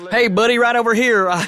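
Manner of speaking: high, loud, hammed-up American accent; thick American accent. Undercover agent hailing his contact, thick American accent. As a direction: holler it in a broad American accent. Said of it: "with that thick American".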